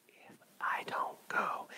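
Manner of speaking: hushed whispered line inside the song; sudden whisper mid-song. Shown as a demonstration. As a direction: drop to a whisper for effect.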